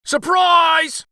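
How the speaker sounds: very high, loud surprise shout; jumping out. Acted. Old man jumping at the king, loud shout. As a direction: leap out yelling.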